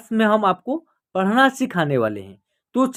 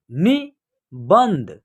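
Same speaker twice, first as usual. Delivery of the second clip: नि-बंध spelled out slowly, each syllable apart; 3 semitones lower, much slower than usual. Shown as a demonstration. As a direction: read निबंध slowly in parts. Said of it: "ऐसे भी आप पढ़ सकते हैं". (निबंध धीरे-धीरे टुकड़ों में)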